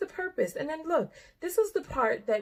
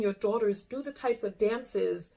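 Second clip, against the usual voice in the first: usual speaking voice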